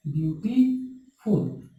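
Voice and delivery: "Beautiful" slowly parted into beauty, फुल; slow two-beat demo of "beautiful"; split into syllables. Shown as a demonstration. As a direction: leave a gap before फुल in "beautiful". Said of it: "दो बार आवाज निकला".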